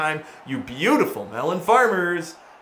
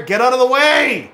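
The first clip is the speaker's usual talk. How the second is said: loud, high, panicky shout; frustrated yell; 9 semitones higher, 6 dB louder than usual. Real, not acted. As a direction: yell at a teammate blocking you. Yells at teammate in game.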